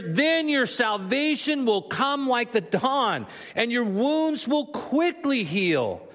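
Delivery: high, slow, deliberate proclamation; emphatic scripture reading. Real, not acted. Rereads the verse for emphasis.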